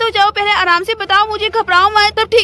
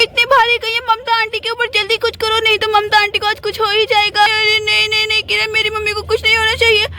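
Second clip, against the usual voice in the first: normal talking voice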